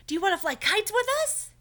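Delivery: high, loud invitation; Bear's voice. Acted. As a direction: call out brightly to a friend. Bear voiced in story.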